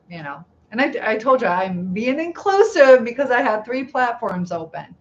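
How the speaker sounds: ordinary delivery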